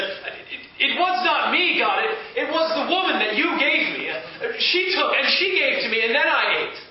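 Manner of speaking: Adam blaming the woman before God, high and loud; quick to defend himself. Acted. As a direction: push the blame off fast and nervously. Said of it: "quick to defend himself".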